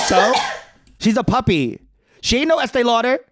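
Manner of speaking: high, sassy snap; sassy retort. Retold or imitated. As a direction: throw it back with a high diva attitude.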